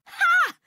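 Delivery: a sharp high loud laugh bark; loud taunting ha. Acted. Game character mocking the loser with a loud ha.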